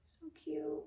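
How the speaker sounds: high, whispered coo. Real, not acted. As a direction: coo it tenderly to the bump. Cooing at her belly.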